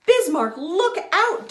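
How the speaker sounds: loud, high warning shout; urgent call to look out; cried warning. Acted. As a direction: yell to warn a friend of danger. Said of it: "Dawn cried".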